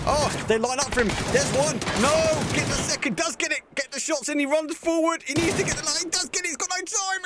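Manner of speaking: high, rushed caster call on every shot; excited play-by-play. Acted. Esports caster calling a fight live for viewers, excited and high. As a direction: call the fight as it happens, voice climbing.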